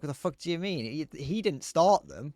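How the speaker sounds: hammed-up disbelief, then drag out the last words; retold exasperated outrage. Streamer retelling his politics rant, mock exasperated outrage. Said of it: "And it's like".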